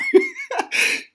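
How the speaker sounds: loud, high-pitched laugh; amused laugh. Real, not acted. Podcaster laughing at his own panicked escape, amused. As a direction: burst out laughing at your own story.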